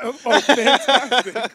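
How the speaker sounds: slow, hammed-up surprise; mock amazement. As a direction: play along as if amazed. Playing along with staged coincidence.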